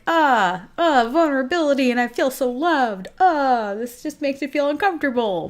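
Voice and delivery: mock-panicked discomfort at love; awkward squirm. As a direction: squirm out loud at being loved. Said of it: "you're like".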